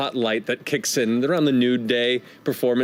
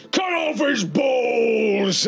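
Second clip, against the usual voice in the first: drawn-out, higher mock yell; rowdy gang heckler; 9 semitones higher, much slower than usual. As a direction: egg on the fighters from the crowd. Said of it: "And the guy's like".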